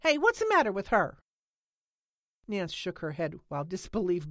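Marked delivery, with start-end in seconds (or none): 0.0-1.2 high, loud question across the yard; calling out. A narrator's reading. Holly calls from the porch asking about Lena, loud. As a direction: shout it from the porch. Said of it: "Holly called from the porch".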